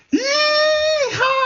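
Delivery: long, very high yee-haw scream; screaming cowboy whoop. Retold or imitated. Sledder on a third run, so into it, screaming. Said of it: "I'm screaming".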